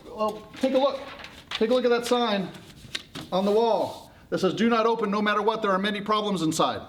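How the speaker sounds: high, insistent crowd voice; exasperated warning. Acted. Everyone showing Pandora the sign, exasperated.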